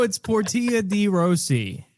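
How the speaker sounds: PORTIA DE ROSSI as por-TEE-a dee RO-see; insisted mispronunciation. Shown as a demonstration. Host overruling co-host, slow por-TEE-a dee RO-see for Portia de Rossi. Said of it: "throw your American twang on it".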